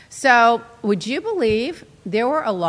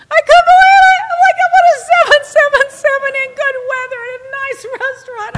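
very high, loud laughing shout of joy; thrilled to be on a seven seven seven; laughing disbelief; 13 semitones higher, 8 dB louder than usual. Real, not acted. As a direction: crow with delight, laughing. Overjoyed at the plane.

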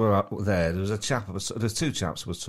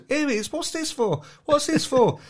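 much higher, eager and amazed; excited exclamation. Retold or imitated. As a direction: gasp it out like a discovery. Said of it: "he stops them all and goes".

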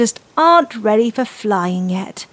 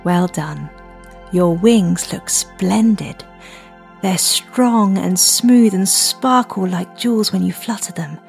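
soft spa whisper; 6 dB quieter than usual. Acted. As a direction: guide the children calmly to breathe. Leads children's breathing meditation.

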